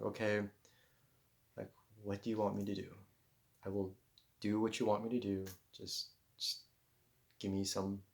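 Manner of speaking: soft, pleading prayer to the universe; hushed plea. Retold or imitated. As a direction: murmur it like a private prayer. Begging the universe for a sign.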